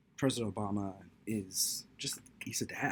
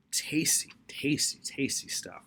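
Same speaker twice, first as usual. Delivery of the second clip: breathy whisper savoring the food; whispered relish; 5 semitones higher, 4 dB louder, slower than usual. Real, not acted. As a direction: whisper tasty, tasty like a treat.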